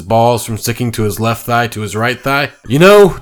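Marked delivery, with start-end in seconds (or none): 2.7-3.2 louder, higher hesitant opener; uneasy Deer Man. Acted. Deer Man backing away from the furries, uneasy. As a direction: back away from them.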